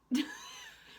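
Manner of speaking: squealing laugh; high laugh. Real, not acted. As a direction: burst into a squeal. Laughing burst.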